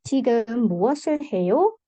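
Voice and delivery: 해요 rising at the end as a question; question intonation. Shown as a demonstration. Teacher demonstrating how a Korean question sounds, rising question intonation on 해요. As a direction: ask it, lifting the pitch on 해요. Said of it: "Kalau pertanyaan nadanya naik".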